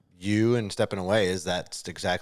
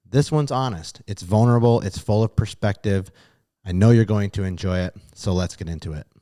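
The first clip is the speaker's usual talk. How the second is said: loud, upbeat announcer delivery of the episode intro; podcast intro read; 10 dB louder than usual. Acted. Host introducing this week's podcast episode, upbeat announcer read. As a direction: sell the episode to listeners like a radio host.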